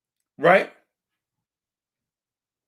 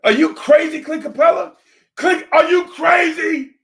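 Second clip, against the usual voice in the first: real yelling; 10 semitones higher than usual. Real, not acted. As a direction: yell it into the mic, fed up. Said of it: "Got me in here raising my voice".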